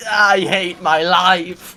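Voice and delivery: slow hammed-up lament; mock despair. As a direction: drag it out in fake misery. Mock despair after laugh.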